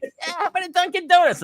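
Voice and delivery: put-on Boston accent. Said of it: "another dude from Boston".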